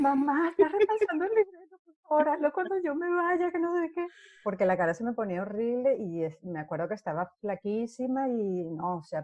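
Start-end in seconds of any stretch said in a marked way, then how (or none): 0.0-4.1 imitating her daughter. Daughter begging her mother to rehearse later, high pleading voice. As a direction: play the annoyed daughter begging mom to stop. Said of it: "Mi hija".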